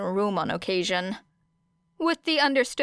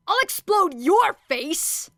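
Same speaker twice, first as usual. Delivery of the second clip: higher, louder, slow angry yell; furious threat; 10 semitones higher, 4 dB louder, slower than usual. A narrator's reading. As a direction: threaten to blow her up. Bakugo threatening across room.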